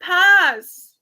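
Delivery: high, loud, firm refusal; emphatic pass. Real, not acted. Instantly rejecting the pick to her friends, loud. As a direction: slam down the verdict.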